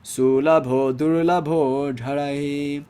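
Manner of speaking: slow, measured recitation of a verse; Sanskrit recitation. Acted. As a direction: intone the Sanskrit line slowly.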